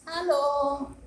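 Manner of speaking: a lightly voiced hand puppet saying hello; a hint of a cheery puppet voice. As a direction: greet the kids as the puppet, only slightly changed. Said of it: "This is a hand puppet".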